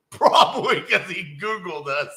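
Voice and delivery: real helpless amusement; laughing. Real, not acted. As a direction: laugh through the punchline. Laughing at the irony.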